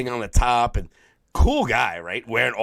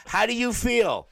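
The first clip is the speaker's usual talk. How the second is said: mock interviewer; 12 semitones higher than usual. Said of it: "interview him".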